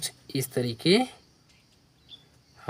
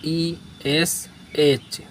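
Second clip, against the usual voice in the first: letter by letter, spaced out; dictating letters to a class; slow spelling; much slower than usual. Shown as a demonstration. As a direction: spell each letter slowly. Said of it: "spelling the letters out".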